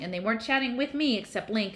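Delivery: ordinary delivery, nothing marked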